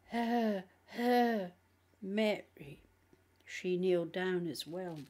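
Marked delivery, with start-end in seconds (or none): 0.1-2.8 demon with forced, grudging respect; forced reverence. A narrator's reading. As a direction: say it with forced awe. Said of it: "a tone of forced reverence".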